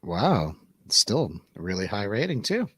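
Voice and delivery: normal talking voice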